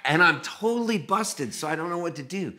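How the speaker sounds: ordinary talk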